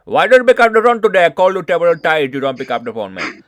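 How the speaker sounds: loud, fast imitation of a heavy Indian accent. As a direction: rattle it off loudly like a caller with a strong Indian accent. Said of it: "they explode my mind with their accent".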